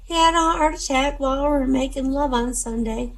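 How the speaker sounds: put-on high-pitched old lady voice; elderly grandmother. Grandmother explaining her husband's death, high granny voice. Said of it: "the grandmother said".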